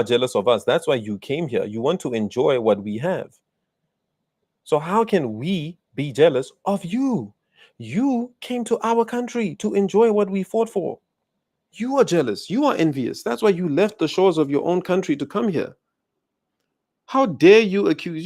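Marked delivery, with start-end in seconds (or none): 4.6-15.8 higher-pitched indignant retort; indignant rebuttal. Real, not acted. Angrily rebutting jealousy accusation. 17.0-18.2 louder, higher burst of outrage; outraged. Real, not acted. Outraged at the accusation.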